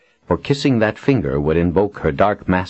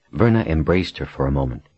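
plain reading voice

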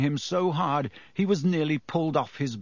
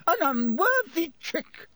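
very high, slow, outraged cry; furious shouting. A narrator's reading. McGonagall to the fallen Slytherins, shouting in fury. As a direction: draw it out in high-pitched indignation. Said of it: "she was shouting".

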